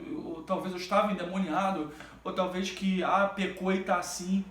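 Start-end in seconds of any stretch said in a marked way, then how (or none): none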